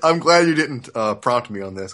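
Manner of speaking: ordinary delivery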